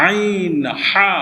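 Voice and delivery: আইন হা stretched very slowly from mid-throat; slow throat sounds. Shown as a demonstration. Teacher drawing out আইন হা slowly for pupils to copy. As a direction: drag আইন হা out from the middle of the throat. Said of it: "হলকের মধ্যখান হইতে".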